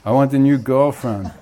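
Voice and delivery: brash, loud, cocky shout at God; bold, loud demand to God. Retold or imitated. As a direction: yell it up to heaven, full of swagger. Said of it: "and shouts".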